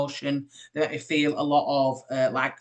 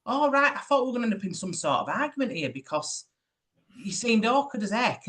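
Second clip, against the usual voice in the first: retold surprise; 7 semitones higher than usual. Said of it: "And I was like".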